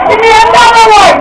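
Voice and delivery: high, loud yell for another round; hammed-up party shouting; rowdy drinker's shout. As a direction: holler at the bartender, acting tipsy. Acting out demanding more drinks.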